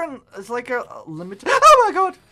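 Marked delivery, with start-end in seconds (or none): 1.5-2.2 high, loud startled cry; real scared shout. Real, not acted. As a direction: jolt out in real fright. Monster chase starts.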